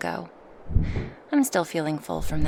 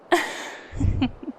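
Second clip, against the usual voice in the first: higher, breathy little laugh; teasing chuckle; 8 semitones higher than usual. Acted. Dragoness joking about dropping her passenger, chuckling. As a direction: chuckle at your own tease.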